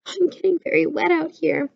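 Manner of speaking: high, small mole voice; a little creature piping up from nearby. A narrator's reading. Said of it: "came Mole's small voice".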